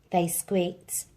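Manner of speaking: ordinary delivery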